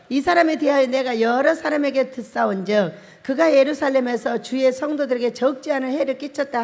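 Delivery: firm, loud congregational reading; leading the church in reading the verse aloud; loud scripture reading. Acted. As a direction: read the Bible verses out loud in unison.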